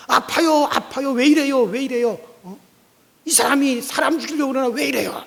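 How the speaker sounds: high, loud pained protest; crying out in pain. Acted. Lame man yelling at the pastor pulling him, loud. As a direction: yelp it as if your arm is wrenched. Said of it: "하고 소리를 질렀습니다".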